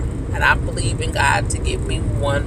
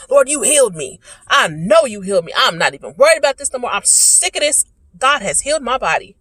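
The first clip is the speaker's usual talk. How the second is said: raised, higher-pitched church testimony; fervent faith declaration; 6 semitones higher, 3 dB louder than usual. Retold or imitated. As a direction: declare it to God with rising conviction.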